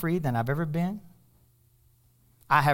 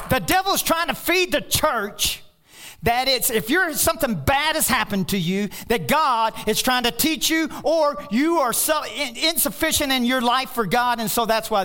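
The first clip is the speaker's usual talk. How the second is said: loud, high preaching; fired-up preacher; preaching at full force; 8 semitones higher, 4 dB louder than usual. Real, not acted. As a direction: rail against false teaching.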